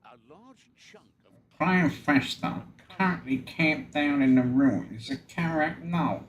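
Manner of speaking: put-on briefing voice, sent up; mocking impression. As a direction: make fun of him with an inexact imitation. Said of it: "I'm trying to make fun of him".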